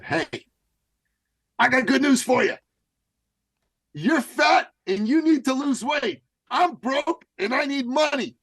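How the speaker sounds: high, loud, brash sales pitch; hammed-up pushy salesman. Coach to hesitant clients, loud high pushy pitch. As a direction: shout the deal like a cheesy huckster. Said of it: "Here's how the conversations went".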